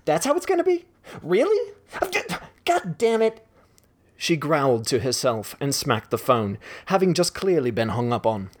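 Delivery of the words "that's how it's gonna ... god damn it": much higher, indignant squawk; outraged disbelief. Acted. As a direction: squeal in disbelief, then curse. Hung up on, outraged.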